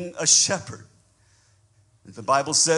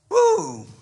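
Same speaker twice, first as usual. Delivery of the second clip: sudden high joyful whoop; preacher's whoop; 7 dB louder than usual. Real, not acted. Pastor whooping to his congregation, high joyful shout. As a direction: let out a shout of joy.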